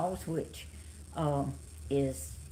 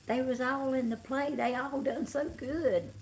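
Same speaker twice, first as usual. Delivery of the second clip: a hint of grandma, pitched up; lightly voiced grandma; 8 semitones higher than usual. Vlogger retelling grandma's praise of the play, lightly voiced higher. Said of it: "Grandma said".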